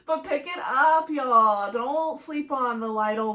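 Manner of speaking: loud excited urging; excited shout. Real, not acted. Urging listeners to buy bread.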